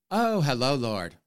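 prissy bishop imitation, a touch of fuss; a hint of a fussy bishop. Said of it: "that's how the bishop sounds".

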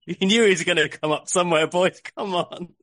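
giggling disbelief at the easy answer; laughing. Real, not acted. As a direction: tease them through real laughter. Host laughing at missed answer.

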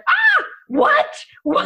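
shrieking high startled "what"; the brain jumps in panic; mock shocked squeal; alarmed brain. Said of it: "Your brain's like".

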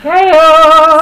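loud happy whoop; excited cheer. Real, not acted. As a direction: cheer like at a party. Celebrating new countries.

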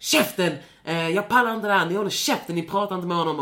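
loud, high angry tirade; scolding shout. Retold or imitated. Scolding the bullies on the bus, loud angry shout. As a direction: tear into them. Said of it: "skriker och skäller ut dem".

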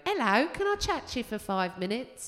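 a cheeky Cockney newcomer begging for stage time; Cockney chirpy voice. Retold or imitated. Open-spot comic to the audience, chirpy Cockney.

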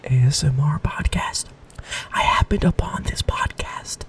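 breathy mock-ASMR whisper. Host reading a fan review as a joke ASMR whisper. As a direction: hush it like an ASMR video.

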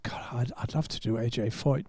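whispered, almost no voice; hushed aside. Retold or imitated. Telling Kenny Brack a secret wish, in a whisper. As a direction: drop to a whisper, confiding.

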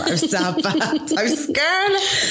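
high, slow, little voice; mock timid confession. Telling the interviewer how nervous she was, mock timid. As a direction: shrink into a small scared voice, half joking. Embarrassed memory of early dating.

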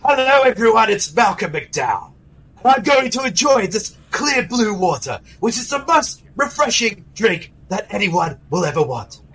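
mock British accent as an actor plugging water; British accent showing off a bottle of water; Malcolm McDowell British accent. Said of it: "a more rugged voice with my British accent".

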